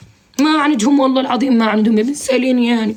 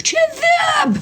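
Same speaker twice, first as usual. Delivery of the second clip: crying shout; much slower than usual. Real, not acted. Cry shout heard.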